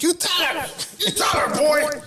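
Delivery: egging on. Retold or imitated. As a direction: cheer him on like a rowdy bystander. Acts out bystanders egging him on.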